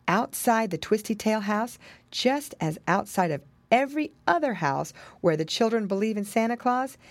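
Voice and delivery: usual speaking voice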